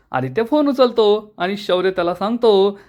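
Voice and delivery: ordinary delivery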